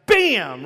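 very loud, explosive "bam"; imitated crash. Dad carrying child into door jamb, explosive crash word. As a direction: burst out with the sound of impact.

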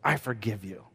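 soft, low, gentle apology; gently forgiving a crying daughter; tender apology. Retold or imitated. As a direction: say it softly to your little girl. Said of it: "I was just like".

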